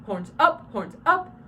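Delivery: loud, punchy call hitting UP; crisp command, no drawl. Shown as a demonstration. Coach shows the right call without accent, crisp command. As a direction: snap out the command short and hard.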